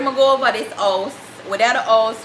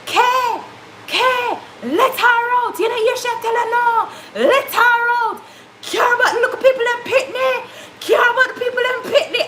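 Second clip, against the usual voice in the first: sister shouting; 11 semitones higher than usual. Retold or imitated. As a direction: shout through the door as the sister. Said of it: "the sister say".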